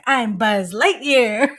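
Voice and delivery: drawn-out heroic toy announcement; mock superhero introduction, stretched out; Buzz Lightyear. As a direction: imitate a kid proudly playing Buzz, slowly. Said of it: "he was like".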